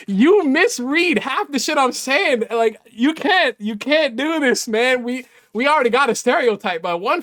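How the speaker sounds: exasperated complaint. Real, not acted. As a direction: drag it out in high exasperation. Exasperated at being misread.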